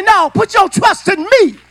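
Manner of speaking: a shouted divine command; God's words, preached high and loud; voicing God. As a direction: boom it as the Lord speaking. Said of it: "He said".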